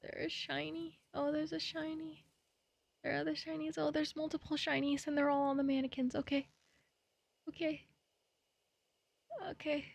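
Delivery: small, high, hushed voice; nervous, quiet. Real, not acted. Streamer spotting shinies on the mannequins, nervous and quiet. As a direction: talk softly to yourself, pitched high.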